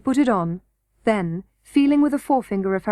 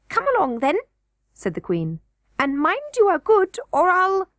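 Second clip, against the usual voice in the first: goblin queen voice; 9 semitones higher than usual. A narrator's reading.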